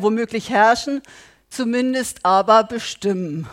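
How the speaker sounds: loud, driving sermon voice; fervent preaching. Acted. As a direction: preach it hard to the congregation. Preaches with rising force.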